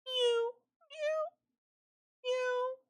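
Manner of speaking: very high, thin cat mew; cat's cry. A narrator's reading. Thirsty cat crying out in the palace, high mewing. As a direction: mew in a tiny falsetto, well above your own voice. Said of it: "and cried".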